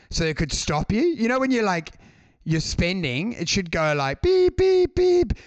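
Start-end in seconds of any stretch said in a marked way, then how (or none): 4.2-5.3 high, loud machine beeping; imitated alarm beep. ATM blocking a drunk customer, high loud beeps. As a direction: beep like a shrill alarm going off.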